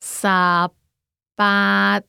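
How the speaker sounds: 十 and 八 said apart with a pause; careful syllable-by-syllable demo; broken down. Shown as a demonstration. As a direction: split the number into syllables for the learner. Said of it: "breaking it down".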